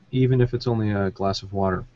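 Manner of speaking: usual speaking voice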